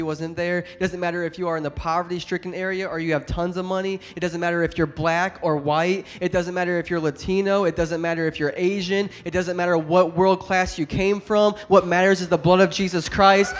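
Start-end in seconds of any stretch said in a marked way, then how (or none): 4.1-13.5 building louder, driving each line home; preaching at full force. Acted. Pastor stirring the congregation, preaching at full force. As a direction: preach it with rising fire.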